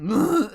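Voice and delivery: loud imitated vomit heave; mock retching. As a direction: heave noisily like a kid throwing up. Imitates the kid throwing up.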